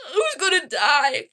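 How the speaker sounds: half-laughing dramatic question; laughing mock dread. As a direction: giggle out the question again. Repeating the trailer worry.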